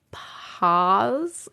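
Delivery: "pause" with an open "ah", rising; tentative "pahz" for "pause"; other people's pronunciation. Shown as a demonstration. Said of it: "That's hard for me to say".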